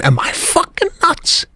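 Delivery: questioning his own sanity, pitched high; incredulous self-doubt. Retold or imitated. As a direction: ask yourself in disbelief. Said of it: "I'm thinking".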